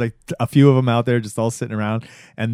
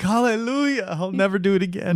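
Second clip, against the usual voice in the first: high, overjoyed mock relief, far higher; joyful mock praise cry; mock hallelujah; 9 semitones higher than usual. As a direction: squeal it like rescued from a chore. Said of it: "You're like".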